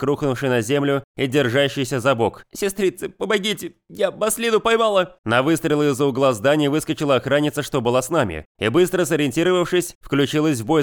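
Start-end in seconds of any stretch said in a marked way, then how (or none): 2.5-5.2 higher, calling out to her sisters; desperate call for help. Acted. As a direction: cry out for help, wounded. Wounded bandit calls sisters. (отчаянный зов на помощь)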